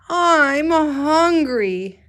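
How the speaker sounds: put-on reading the hungry boy's line to the class; hungry whine. Shown as a demonstration. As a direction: drag it out like a starving kid. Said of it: "important to use your voice".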